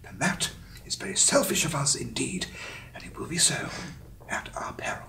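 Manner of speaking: high hushed nature-documentary whisper; David Attenborough. Retold or imitated. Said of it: "that impression's thrown me".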